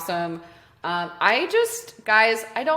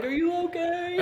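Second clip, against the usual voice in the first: much higher, softer questioning voice; local guy; 9 semitones higher, 5 dB quieter than usual. Retold or imitated. Local guy to stranded swimmer, high soft voice. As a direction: put on a high, soft voice to check. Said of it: "local guy is like".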